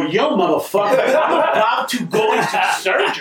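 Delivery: high, near-shouted comic outrage; hammed-up indignant yelling; retold panicked protest. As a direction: replay your alarm, laughter breaking through. Retells panicked protest before surgery.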